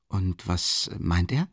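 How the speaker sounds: dreading the answer, slowly; frightened. A narrator's reading. Raskolnikov asks about the investigator, frightened and slow. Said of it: "fragte Raskolnikow erschrocken".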